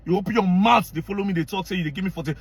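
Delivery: ordinary talk, nothing marked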